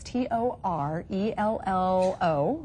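slow, measured letter-by-letter spelling. Real, not acted. Host spells the guest's website for viewers, slow and deliberate. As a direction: spell it slowly, one letter at a time.

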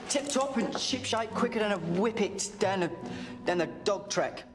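cheery put-on Cockney; chirpy Cockney servant voice; Cockney accent. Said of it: "The accent".

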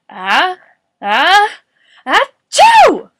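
acted sneeze climbing to a loud, high achoo; building sneeze. A narrator's reading. Morris with a cold, sneezing loudly. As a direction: wind up, then explode into a sneeze. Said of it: "Morris sneezed".

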